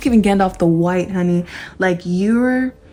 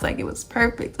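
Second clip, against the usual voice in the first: whisper; 4 semitones higher, 8 dB quieter than usual. Pretend. Old man's fulfilled reaction.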